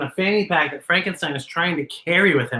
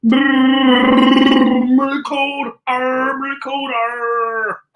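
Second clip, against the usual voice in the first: shivering monster; 3 semitones higher, 3 dB louder, much slower than usual. Acted. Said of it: "He's like".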